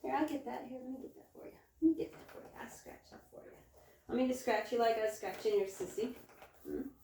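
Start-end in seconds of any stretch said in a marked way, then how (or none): 0.4-3.6 soft whisper to the goat. Real, not acted. Scratching the goat's itch, gentle whisper. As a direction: whisper tenderly while scratching.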